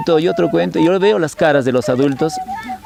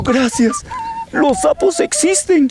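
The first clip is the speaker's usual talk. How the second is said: high, slow tiny voice; little worm character; slower than usual. Acted. Little worm thanks the butterfly and ant, high and slow. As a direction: squeak thanks, stretched out and awed.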